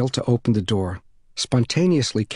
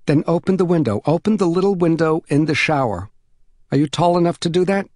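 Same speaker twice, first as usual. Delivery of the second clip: higher, encouraging instruction; helpful neighbor mother; 6 semitones higher, 4 dB louder than usual. Acted. Mother directing the stuck boy to the window, higher and encouraging. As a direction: call instructions through a door, motherly.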